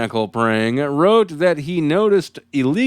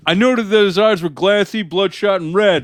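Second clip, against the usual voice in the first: higher, stiff police-report voice; cop voice; 7 semitones higher than usual. Retold or imitated. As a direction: read it like a by-the-book trooper. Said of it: "let me do my cop voice".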